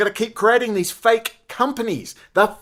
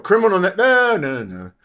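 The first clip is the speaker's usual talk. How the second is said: usual speaking voice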